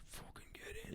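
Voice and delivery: secret whispered cheer; whispered inner celebration; whispered glee. Retold or imitated. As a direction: hiss it under the breath, delighted. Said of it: "part of me was like".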